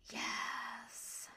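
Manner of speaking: drawn-out, hissing "yes"; hissed. A narrator's reading. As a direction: hiss it slowly through the final s. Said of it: "Elodie hissed".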